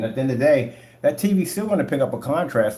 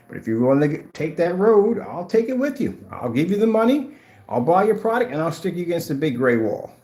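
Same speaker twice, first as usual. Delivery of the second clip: taunting challenge; 7 semitones higher, 4 dB louder than usual. Real, not acted. Daring critics to take challenge.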